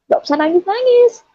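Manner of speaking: high-pitched retold plea; retold protest. Niece tells her crying uncle to stop, high-pitched protest.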